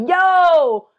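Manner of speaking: loud, high call of the nickname; brother calling out. Retold or imitated. Brother greeting his sister by nickname, loud and high. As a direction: shout the nickname like a Brooklyn brother. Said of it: "He'll be like".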